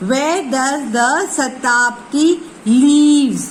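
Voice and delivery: "leaves" stressed, the line said slowly; slow, hitting the word "leaves". Shown as a demonstration. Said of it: "Now leaves, I said. So I am stressing the word".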